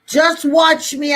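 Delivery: normal talking voice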